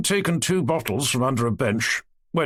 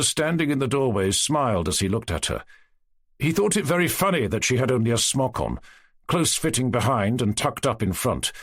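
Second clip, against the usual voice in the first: plain reading voice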